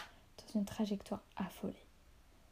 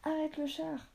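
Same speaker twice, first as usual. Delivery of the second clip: high, strained command; raised-pitch cry in mid-battle; urgent shouted order; 7 semitones higher than usual. A narrator's reading. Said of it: "cria Barras en essayant".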